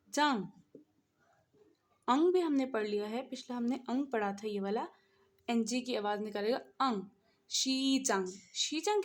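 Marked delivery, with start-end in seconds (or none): none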